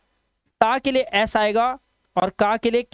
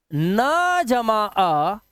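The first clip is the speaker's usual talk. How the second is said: slow sound sum; much slower than usual. Shown as a demonstration. Teacher slowly building the sound for students. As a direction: say "क जमा अ" very slowly, spacing out each part. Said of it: "यानी कि ये क्या है".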